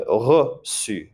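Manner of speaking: "reçu" with "uh" in the first syllable, then S; mute E. Shown as a demonstration. Teacher contrasting two vowels, "reçu" with a mute E. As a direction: draw out "reçu" slowly as ruh-SU. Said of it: "we'll say mute E".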